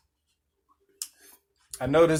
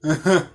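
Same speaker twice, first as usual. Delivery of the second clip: short laugh; 5 semitones higher, 5 dB louder than usual. Real, not acted. Laughter after telling it.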